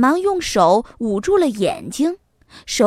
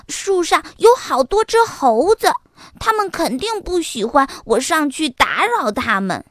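eager little fox; 4 semitones higher than usual. Acted. Little fox warning mama about monkeys, animated. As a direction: start high and animated like a youngster.

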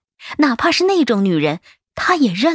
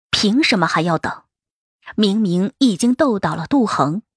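usual speaking voice

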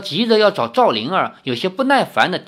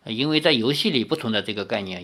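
usual speaking voice

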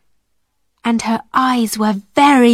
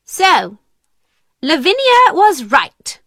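raised, stern headmistress voice; angry; 6 semitones higher, 4 dB louder than usual. A narrator's reading. Miss Minchin catching the girls at their party, angry. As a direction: pounce on them, pitch jumping up. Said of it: "she said angrily".

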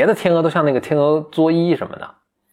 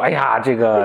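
stagey voice; 2 semitones lower, slower than usual. Retold or imitated. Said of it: "你这是北京人艺版吗".

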